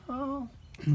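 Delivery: high, soft mock miaow; cat miaow. Reading to a child, a high soft miaow.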